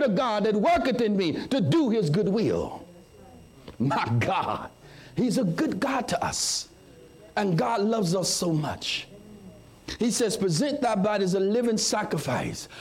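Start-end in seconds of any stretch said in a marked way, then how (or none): none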